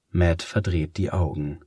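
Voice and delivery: plain reading voice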